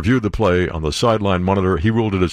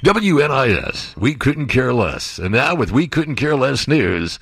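mock station slogan; 4 semitones higher, slower than usual.

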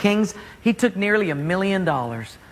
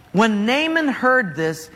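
ordinary talk, nothing marked

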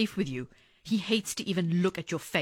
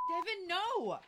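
high and much softer, urgent; frightened plea; 9 semitones higher, 10 dB quieter, slower than usual. Acted. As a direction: hold him back in fear. Wife stops boyfriend attacking.

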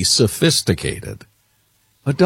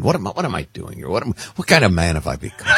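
ordinary talk, nothing marked

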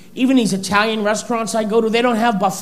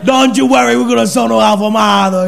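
Italian waiter exclaiming; 2 semitones higher, 4 dB louder than usual. Retold or imitated. Waiter shouting the phrase back in Italian accent.

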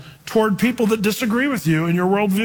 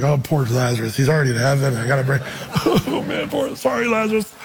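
mock pity; 4 semitones lower than usual. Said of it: "He's like".

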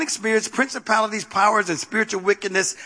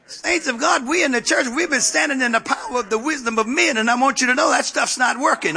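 higher-pitched, forceful preacher's exhortation; preaching; 6 semitones higher than usual. Real, not acted. Preacher tells the church what's not working, preaching. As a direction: press it urgently on the congregation.

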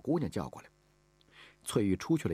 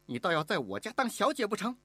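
huffy, high-pitched sneer; scornful; 14 semitones higher than usual. Acted. Mistress mocks the maid's wish to study, scornfully. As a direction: scoff at her pretensions. Mistress scoffs at girl's schooling.

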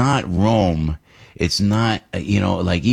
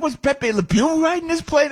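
mock disbelief. Exasperated joke about the stop.

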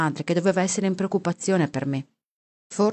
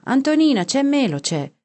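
high, loud call to someone indoors; raised, high-pitched call; 8 semitones higher, 4 dB louder than usual. A narrator's reading. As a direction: shout the news across to the wife. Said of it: "gridò verso la moglie".

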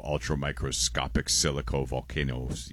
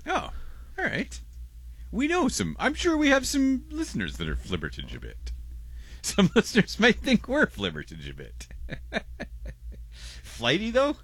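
teasing high voice. Acted. Teasing listeners, high mock voice.

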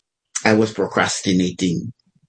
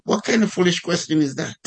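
high-pitched, mock-indignant comeback; indignant retort; 8 semitones higher than usual. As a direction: fire back in mock disbelief, pitched up. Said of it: "you wouldn't say it like that".

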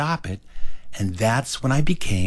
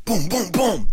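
high-pitched, insistent booms; harder imitated knocking; 9 semitones higher, slower than usual. Boy knocks after being told louder, insistent booms. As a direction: pound out the knocks by voice. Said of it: "You didn't knock loud enough".

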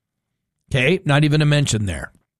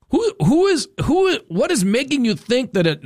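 disbelief; 8 semitones higher than usual. Real, not acted. Host keeps up disbelief.

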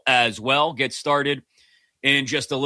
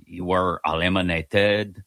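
hammed-up, terrible French accent; slow, badly faked French accent; 6 semitones lower than usual. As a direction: apologize in a deliberately bad French accent. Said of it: "I'll do this terrible accent".

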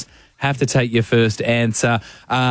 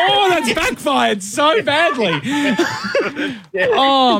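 laughing; 13 semitones higher, much slower than usual. Real, not acted. Host laughing at caller's answer.

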